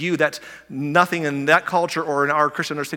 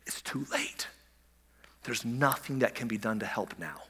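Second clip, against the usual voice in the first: hushed whisper, much softer, unvoiced; 5 semitones lower, 11 dB quieter than usual. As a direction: breathe the verdict under your voice. Final verdict, voiced hushed.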